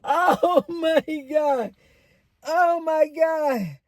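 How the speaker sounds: high, slow, drawn-out exclamation; gleeful disbelief. Real, not acted. Storyteller reacting to the younger-man punchline, gleeful disbelief. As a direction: stretch each word high in astonishment.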